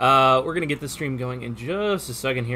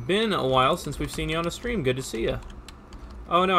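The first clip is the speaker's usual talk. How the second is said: ordinary delivery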